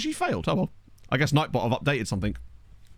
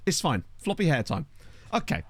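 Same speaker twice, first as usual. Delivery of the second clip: ordinary talk, nothing marked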